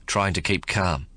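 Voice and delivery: usual speaking voice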